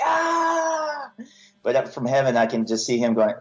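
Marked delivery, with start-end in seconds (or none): 0.0-1.1 a sudden high raging yell; prayer yelled in anger, a raw high cry; yelling at God. Retold or imitated. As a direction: let out a howl of frustration, way up high. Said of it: "like, yelling".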